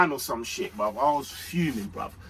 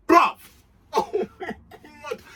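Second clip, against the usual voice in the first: laughing; 11 semitones higher, 4 dB louder than usual. Real, not acted. Laughing at his own rating.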